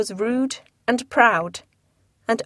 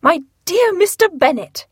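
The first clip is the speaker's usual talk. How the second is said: a lightly higher, fussy wife; Mrs Bennet voiced; 8 semitones higher, 4 dB louder than usual. Wife calling to her husband, lightly higher and fussy.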